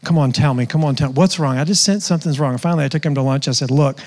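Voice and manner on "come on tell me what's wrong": normal talking voice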